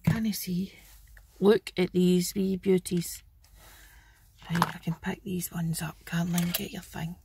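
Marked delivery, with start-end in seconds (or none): 4.5-7.2 soft unvoiced whisper, under the breath; whispered aside. Real, not acted. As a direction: whisper it to yourself while working. Quiet aside while picking up.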